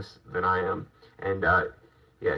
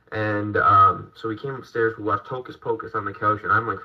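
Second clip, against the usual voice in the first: ordinary talk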